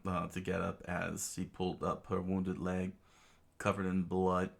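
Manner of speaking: plain reading voice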